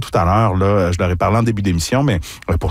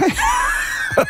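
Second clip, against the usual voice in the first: duck call; 3 dB quieter than usual. Shown as a demonstration. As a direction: honk like a mallard using just your hand. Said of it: "Alors, simplement avec la main".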